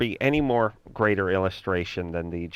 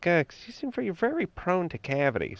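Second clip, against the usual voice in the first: high, soft condescending dentist; lightly mocking a preachy dentist; mimicking the dentist; 7 semitones higher, 6 dB quieter than usual. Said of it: "It's like".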